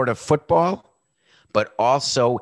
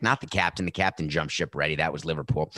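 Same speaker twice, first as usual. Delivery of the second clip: ordinary talk, nothing marked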